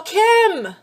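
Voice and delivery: high, loud exasperated rant; exasperated husband. Retold or imitated. Husband fed up with wife's pork chop questions, high loud rant.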